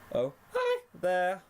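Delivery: high cracked squeak, slow; high squeaky pony voice. A narrator's reading. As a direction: squeak out a sweet greeting. Sweetie Belle greets, voice cracking.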